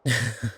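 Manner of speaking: brief, loud, breath-led chuckle; knowing chuckle. Acted. Vampire found companion easily, brief knowing chuckle. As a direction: chuckle shortly, sure of yourself.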